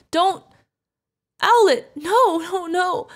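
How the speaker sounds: loud, high cries of alarm; horrified cry. Acted. Guardian sees owlet get hurt, horrified cry. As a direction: cry out in panic.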